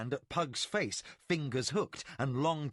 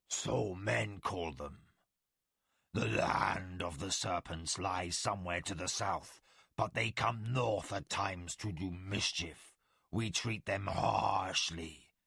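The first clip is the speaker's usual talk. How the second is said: slow, lower creature snarl; defiant, holding back a growl; snarling tiger warrior; 6 semitones lower than usual. Acted. Chuan on serpents.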